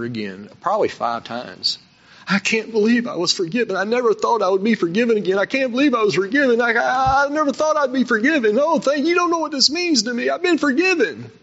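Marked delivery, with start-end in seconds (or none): 2.3-11.3 high, fast, choked-up voice fighting back tears; overwhelmed sobbing gratitude, much higher and a bit louder; tearful crying plea. Retold or imitated. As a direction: cry out in disbelief at being forgiven. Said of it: "he's fighting the tears, and he's crying, and he's shaking".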